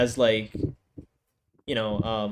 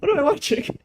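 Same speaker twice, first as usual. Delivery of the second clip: high, whiny cry of disbelief; mock despair; 12 semitones higher than usual. Real, not acted. Reviewer despairing at the show, whiny mock cry. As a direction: wail it like you're about to cry. Baffled by the anime plot.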